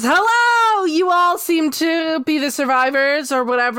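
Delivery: a theatrical host booming hello; top hat host. Acted. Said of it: "the dude in the top hat comes back and he goes".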